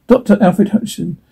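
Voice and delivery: normal talking voice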